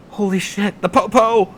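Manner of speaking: high, loud panicked yelp; startled outburst. Acted. Young driver spotting police lights behind him, loud high panicked yelp. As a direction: blurt it out in sudden alarm.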